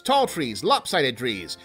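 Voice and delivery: usual speaking voice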